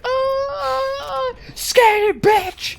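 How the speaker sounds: shrill-pitched cracked shout, hammed-up; high mock yell. Robber yelling at the clerk to scan it, high mock yell.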